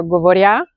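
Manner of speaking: govoria stressed on the last syllable, govori-A, rising; wrong stress. Shown as a demonstration. Teacher offering learners a wrong option, govoria with end stress. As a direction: push the final ya of govoria up. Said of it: "No, I say it govoria".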